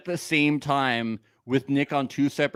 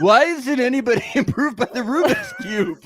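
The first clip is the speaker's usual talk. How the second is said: mock complaint; 6 semitones higher, 4 dB louder than usual. Voices janitor wanting people impressed.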